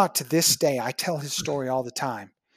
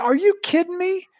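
much higher, louder, a delighted squeal of disbelief; excited disbelief; 14 semitones higher, 6 dB louder than usual. Retold or imitated. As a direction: toss it up high in happy surprise. Retelling reply to grateful patient.

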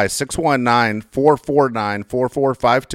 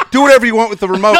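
louder, fast, snapped at the husband; irritated wife; 8 semitones higher, 9 dB louder, faster than usual. Retold or imitated. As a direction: fire it off like an annoyed wife in bed. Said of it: "Then she's like".

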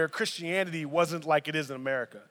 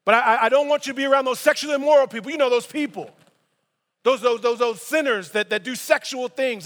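animated Paul voice; 5 semitones higher, 7 dB louder than usual. Retold or imitated. Voicing Paul's warning.

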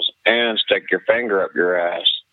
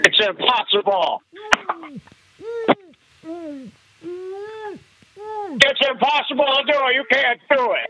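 high voice. Acted. High caller voice.

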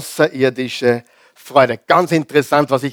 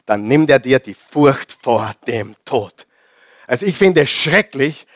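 normal talking voice

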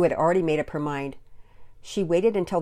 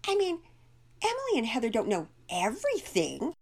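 put-on higher girlish voice with an edge; dismissive child, pitched up; slight edge; 8 semitones higher than usual. A narrator's reading. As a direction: let some edge into a high young voice. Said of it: "a slight edge creeping into her voice".

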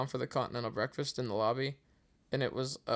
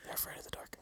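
whispered aside; 14 dB quieter, faster than usual. Real, not acted. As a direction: hush it like a secret.

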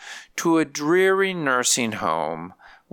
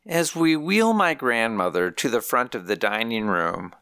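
ordinary delivery, nothing marked

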